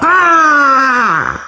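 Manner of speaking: long, high death scream falling away; agonized scream. Acted. Liu Xueqiao stabbed in a fatal spot, agonized scream. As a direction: scream high and drawn out, then drop. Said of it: "惨叫一声".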